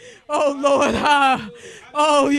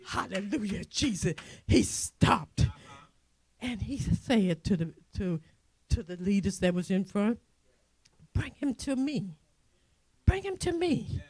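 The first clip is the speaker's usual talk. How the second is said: hushed storytelling; 8 semitones lower, 11 dB quieter than usual. Acted. Preacher tells the church how Jesus stopped, hushed. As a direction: drop to a hush to tell the story.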